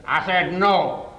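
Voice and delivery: imitating a stern commander; loud slow refusal. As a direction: hammer the refusal home.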